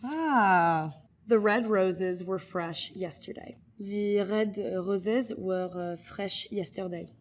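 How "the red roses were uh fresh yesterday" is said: slow French-accented reading with French R's. Shown as a demonstration. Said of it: "R's are distinctly very French".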